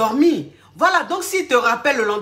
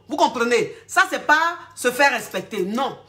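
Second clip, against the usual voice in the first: ordinary talk, nothing marked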